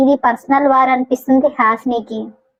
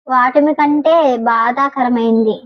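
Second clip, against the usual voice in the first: ordinary delivery, nothing marked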